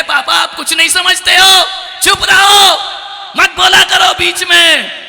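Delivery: high, loud, scolding shout; angry son. Acted. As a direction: yell father down. Son angrily silencing his father.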